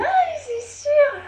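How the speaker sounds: très aigu, lent, en riant; un rire pincé qui s'étire; réplique en riant. A narrator's reading. (la sœur répond en riant, très aigu et lent)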